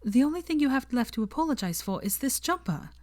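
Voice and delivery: lightly voiced girl scolding through tears; Hermione voice. Hermione's lines voiced higher.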